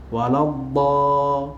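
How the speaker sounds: "Waladda-" drawn out very slowly; teacher chanting Al-Fatihah; demonstrating a recitation. Shown as a demonstration. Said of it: "nak sebut waladdallin tak betul".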